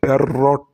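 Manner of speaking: "প্যারট" with a long trilled r in the middle; curved, trilling "প্যারট"; rolled R. Shown as a demonstration. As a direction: roll the r hard in "প্যারট". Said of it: "উচ্চারণটা ওই রকম করেই করতে হবে".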